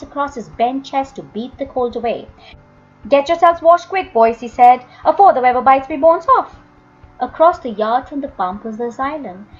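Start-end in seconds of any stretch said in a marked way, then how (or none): 3.1-6.6 put-on old man hollering at the washing boys, fast at the end; shouting old man. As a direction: bark the order over the cold. Joseph yells at the boys.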